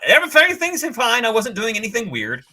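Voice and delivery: high, fast, nervous denial; squeaky panicked protest; flustered. Retold or imitated. Caught hugging the statue, flustered.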